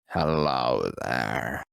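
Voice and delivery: low, slow, cold greeting; deep actor voice. Retold or imitated. Voice actor greeting the kid in a low slow drawl.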